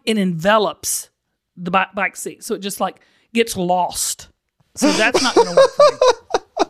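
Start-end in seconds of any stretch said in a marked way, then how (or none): 4.7-6.7 high, loud, slowed by laughter; real laughter breaking up the line; laughing while talking. Real, not acted. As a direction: crack up through the words. Cracking up at own story.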